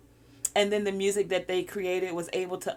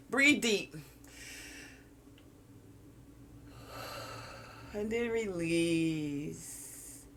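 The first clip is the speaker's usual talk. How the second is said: guided breathing. Acted. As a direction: ease them through each breath. Leads breathing exercise.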